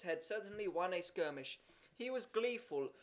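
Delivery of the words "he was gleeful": ordinary delivery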